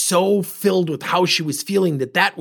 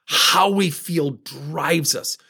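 ordinary talk

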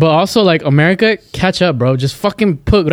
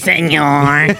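drawn-out, exaggerated servile reply; mock submissive; much slower than usual. Friend jokes about obeying police, mock submissive. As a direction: play at grovelling to an officer. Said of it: "gotta be like a fucking bitch".

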